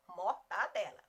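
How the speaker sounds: MORTADELA with a pure TA, "mor-ta-DE-la"; correct pronunciation. Shown as a demonstration. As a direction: say MORTADELA in separate syllables, no nasal. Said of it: "na hora de escrever é mortadela".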